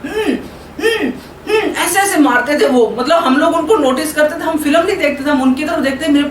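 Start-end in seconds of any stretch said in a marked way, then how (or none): 0.1-1.6 high mock fighting grunts with a laugh; imitating father's angry grunts. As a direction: mimic a dad punching along with the hero. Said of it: "उनको भी ऐसे गुस्सा आता था".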